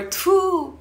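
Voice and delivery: high spitting tfu; disgusted spit sound. Shown as a demonstration. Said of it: "If you're disgusted".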